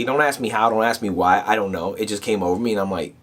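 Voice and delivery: usual speaking voice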